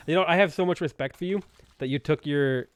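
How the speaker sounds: ordinary delivery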